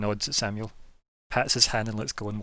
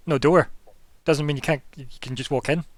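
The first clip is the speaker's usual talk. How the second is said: indignant retort; 4 semitones higher, 7 dB louder than usual. Acted. Vampire argues back.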